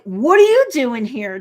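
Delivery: loud, swooping mock surprise; startled challenge. Nikki breaking in on Jen, startled challenge. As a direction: imitate a friend bursting in, incredulous. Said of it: "Nikki breaks in".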